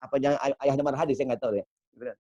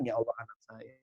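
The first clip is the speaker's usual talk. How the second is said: happy crying; 3 semitones lower, 8 dB quieter than usual. Retold or imitated. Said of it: "nangis girang".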